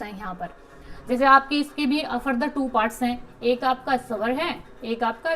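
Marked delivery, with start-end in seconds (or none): none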